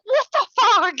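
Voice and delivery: loud, high mock outburst; shocked yell. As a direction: imitate a man yelling in shock. Everybody screaming, he reacts.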